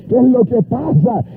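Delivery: high revival cadence rising to a stretched praise cry; impassioned preaching. Retold or imitated. Preacher retells a convert overwhelmed by love, fervent. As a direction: drive the retold exchange upward into praise.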